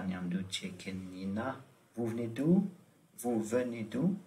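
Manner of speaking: ordinary talk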